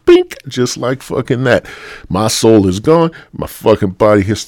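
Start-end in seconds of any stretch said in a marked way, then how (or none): 0.1-0.4 sound-effect bink. Retold or imitated. The sound of sudden death.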